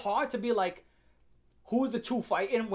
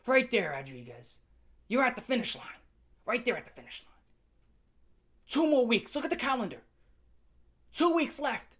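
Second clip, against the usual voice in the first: coach's pep talk; 4 semitones higher than usual. Retold or imitated. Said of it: "he did his whole entire speech".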